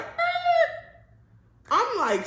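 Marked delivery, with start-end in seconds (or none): 0.0-1.0 very high imitated record-scratch screech. Stopped short by her friend's proposition, imitated record scratch. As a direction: make a sudden high-pitched scratch noise. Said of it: "when a record scratch and it's like".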